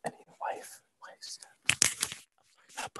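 soft whisper, no voice; whispered aside. Pretend. Teasing co-host about wife stuff, sly whisper. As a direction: breathe the words like a secret.

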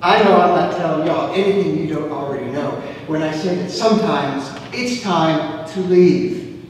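ordinary talk

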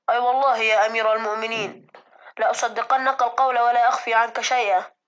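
swift, loud earnest oath; loud pleading servant. Acted. Servant swears honesty to the caliph, loud and earnest. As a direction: swear it to him with all your heart.

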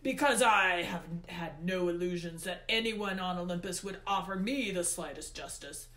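forceful, controlled god's speech; Hades, indignant. A narrator's reading. Hades explains why he hid his loss, indignant. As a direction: begin loudly, keep up the bitter emphasis.

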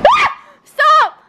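shrieking high and loud; real fright, yell stop; panicked high shout; startled scream. Real, not acted. Pet jumps on her.

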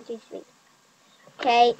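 normal talking voice

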